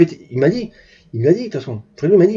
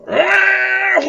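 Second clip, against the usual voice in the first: sustained high engine scream; imitated speeding engine; 13 semitones higher than usual. Storyteller shows the run past the radar, high engine scream. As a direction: mimic a car at top speed. Mimics car passing the radar.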